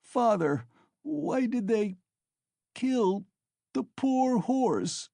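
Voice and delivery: high, slow, breaking plea; sobbing. A narrator's reading. Boy asking his father why they killed the horse, sobbing. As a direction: sob the question to his father. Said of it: "he sobbed".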